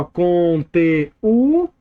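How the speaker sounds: "conteúdo" drawn out slowly, syllable by syllable; slow teacher's sounding-out of "conteúdo"; syllable-by-syllable demo. Shown as a demonstration. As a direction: stretch each syllable of "conteúdo", lingering on the ú. Teacher stretches syllables of example word.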